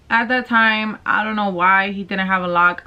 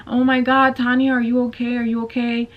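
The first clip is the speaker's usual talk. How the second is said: higher-pitched, lightly voiced worried mom; concerned mother; 5 semitones higher, faster than usual. Boyfriend's mom walks past the crying girl, mildly acted concern. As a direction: imitate a mother's alarmed check-in.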